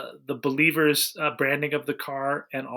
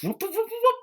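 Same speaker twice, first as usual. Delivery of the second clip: rising whoop sounds, much higher, like a gadget folding out; imitated whooshing; much faster than usual. Describing the movie's steering wheel, imitated mechanical whoops. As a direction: mimic the steering wheel popping out with quick whoops. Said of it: "goes like this".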